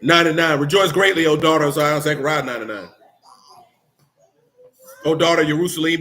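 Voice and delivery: loud, declaiming preacher voice; full-force preaching. Acted. Preacher reading scripture to the congregation, loud proclamation. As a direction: proclaim it to the whole church.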